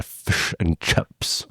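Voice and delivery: New Zealand vowels, "fish and chips" slow and hushed with the I sounds squashed out; New Zealand accent. Shown as a demonstration. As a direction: say "fish and chips" in New Zealand style, nearly whispered as "fush and chups". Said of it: "elide the I sounds and say something akin".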